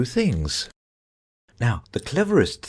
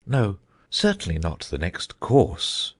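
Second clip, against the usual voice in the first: slow, thoughtful. A narrator's reading. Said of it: "in a slow, thoughtful tone".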